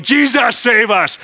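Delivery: high, loud cry for rescue. Retold or imitated. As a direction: call out to Jesus in panic. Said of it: "crying that out".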